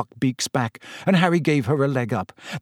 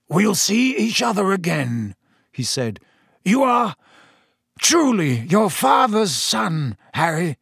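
higher, slowed, heartfelt farewell; moved Sirius; 6 semitones higher than usual. Acted. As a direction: let the pride land on father's son.

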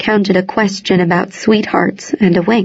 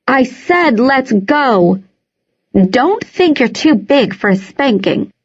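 raised voice; 4 semitones higher than usual. A narrator's reading. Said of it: "Naomi raised her voice".

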